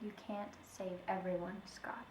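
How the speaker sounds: hushed, breathy whisper. Acted. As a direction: whisper it close. Widow pleads with detective softly.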